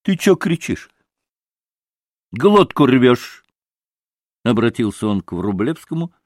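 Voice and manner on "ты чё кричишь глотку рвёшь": raised, loud and rude retort; discourteous innkeeper, drawing out the last words; rude rebuke. A narrator's reading. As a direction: tell him off rudely. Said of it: "с какой-то непонятной даже невежливостью".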